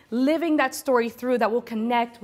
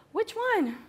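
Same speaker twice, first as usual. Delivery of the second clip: mamma smemorata; 8 semitones higher, 4 dB quieter than usual. Retold or imitated. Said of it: "And she's like".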